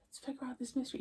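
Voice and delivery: a quiet echo of her own line, almost to herself; soft echo. Shown as a demonstration.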